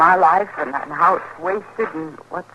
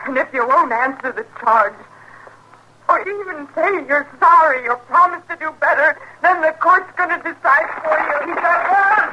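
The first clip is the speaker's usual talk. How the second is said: rising to a cry; 8 semitones higher than usual. Acted. Wife working herself up to shoot her husband, building to a high cry. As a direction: climb step by step until it breaks.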